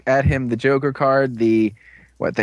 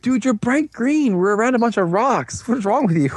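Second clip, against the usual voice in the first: high-pitched exasperated scolding; mock disbelief; 9 semitones higher than usual. Storyteller as teammates to the hider, high exasperated disbelief. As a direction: scold in a raised, incredulous voice.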